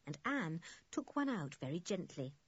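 plain reading voice

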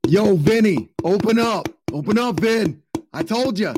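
a light New York crook begging to get in; New York mobster. Pretend. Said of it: "accent from New York City".

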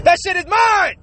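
high, loud hammed-up looter shouting; a toilet-paper thief yelling at shoppers, hammed up; mock looter yell. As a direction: bark it like a crazed looter grabbing toilet paper. Imitating looter.

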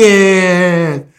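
LEAKING loud and stretched out; drawn-out emphasis. Real, not acted. Describing the broken nose, "leaking" drawn out loud. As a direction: hit "leaking" hard and hold it long.

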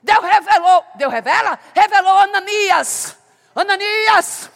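loud, high proclaiming; fervent preacher's cry; preaching at full force. Acted. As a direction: call it out to the congregation. Preacher proclaiming God's revelation.

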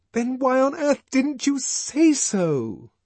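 high, loud, slow exasperated cry. Acted. Lenina crying out at John in exasperation. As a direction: burst out at him in exasperation. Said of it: "she cried".

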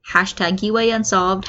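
plain reading voice

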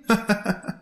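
soft, short string of chuckles; teasing laugh from far off; playful laugh. Acted. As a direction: laugh lightly after calling. Father laughs playfully from afar.

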